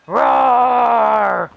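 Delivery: loud shouted monster roar. Acted. Frankenstein bursting free on Halloween, shouted roar.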